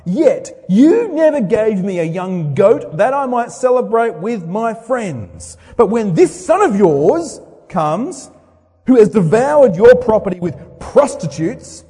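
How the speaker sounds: loud, bitter protest, high and hard; resentful older son. Acted. Older son shouting his grievance at his father. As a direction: throw years of grievance at the father. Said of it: "That's my speech".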